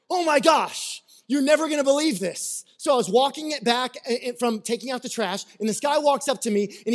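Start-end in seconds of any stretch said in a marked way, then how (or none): 0.1-2.6 high, eager gush of big news; excited news. Pretend. Neighbor bursting through the door, excited. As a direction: burst in with can't-wait gossip.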